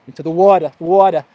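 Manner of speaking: "water" said the New York way, ending in an open vowel; New York accent. Real, not acted. Vlogger heading into the sea, New York accent slipping out. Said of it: "That's my New York accent coming through".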